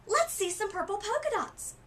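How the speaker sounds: put-on high, loud kid's yell; yelled taunt. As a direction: call it out across the classroom. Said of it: "One yelled out".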